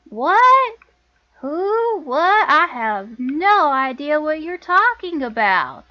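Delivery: feigned bafflement. Acted. Lana denying her sister's accusation, over-the-top bafflement. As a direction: play innocent, loudly and drawn out.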